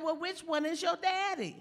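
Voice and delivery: high, puzzled asking; puzzled question. Retold or imitated. Teller asking the little girl in a high puzzled voice. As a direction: raise the pitch in disbelief.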